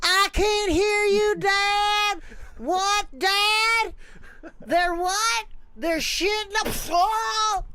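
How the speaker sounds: very high, loud, slow cartoon-kid shout; Bobby Hill voice yelling at Dad. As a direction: yell like a confused cartoon boy. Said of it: "That was my Bobby Hill".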